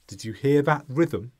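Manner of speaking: normal talking voice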